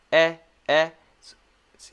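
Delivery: eh said relaxed, mouth wider; short e, relaxed. Shown as a demonstration. Teacher showing the relaxed mouth for eh. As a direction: model a relaxed eh. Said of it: "when I say the eh sound".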